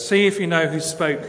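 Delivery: ordinary delivery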